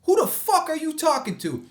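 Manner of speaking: high, loud challenge; mock confrontation. Squaring up to a stranger in the lot, mock angry. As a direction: square up to him.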